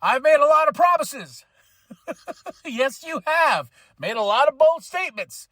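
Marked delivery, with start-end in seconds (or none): 0.0-1.4 higher, louder announcer impression; Don Callis voice. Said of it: "he says". 2.6-3.7 slow, dry sarcastic reply. Real, not acted.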